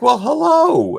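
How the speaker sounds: high, sweet coo to a new puppy; gushing hello to the dog; cooing greeting. Retold or imitated. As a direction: melt over the dog, cooing. Daughter greets new dog, welling up.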